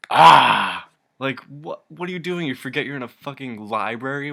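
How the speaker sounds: sudden loud high yell; mock shout. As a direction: cry out in disbelief, high and loud. Mocking his library outburst.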